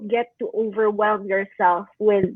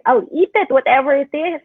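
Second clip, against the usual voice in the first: ordinary delivery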